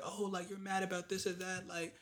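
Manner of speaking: high, soft imitation of a grandmother; lightly acted gentle reassurance; consoling grandmother. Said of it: "she's just like".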